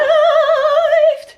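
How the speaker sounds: squealing laugh; loud high laugh. Real, not acted. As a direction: burst out laughing.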